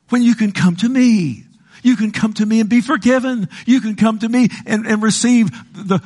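high, insistent appeal; pleading as God. Retold or imitated. God inviting sinners to be forgiven, high urgent plea. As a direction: raise the pitch and urge them over. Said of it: "listen to what God says".

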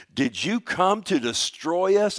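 loud, high, slow demand; demon crying out. Retold or imitated. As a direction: cry it out as an accusing question. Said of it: "he cried out with a loud voice".

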